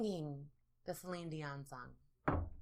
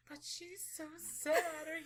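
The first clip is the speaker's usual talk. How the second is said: mock weepy; 10 semitones higher, 5 dB louder than usual. Movie watcher overcome by a sad love story, mock sobbing. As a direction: blubber over a screen romance, hammed up.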